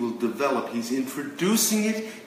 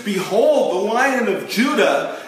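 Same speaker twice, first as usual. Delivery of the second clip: higher, louder proclamation of Behold; proclaiming loudly; 7 semitones higher, 6 dB louder than usual. Acted. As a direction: ring it out like a preacher at full force. Preacher proclaims the vision.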